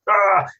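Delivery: short high loud frustrated shout; frustrated yell. Retold or imitated. Dad snaps at son over homework, loud frustrated yell. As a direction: blurt out an exasperated yell. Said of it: "I actually lost my temper".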